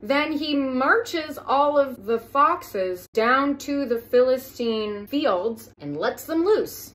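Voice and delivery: slow, disbelieving storytelling; slow incredulous. Acted. Comedian baffled by the fox story, slow and disbelieving. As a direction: spell out each absurd detail slowly.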